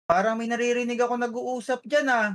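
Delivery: ordinary delivery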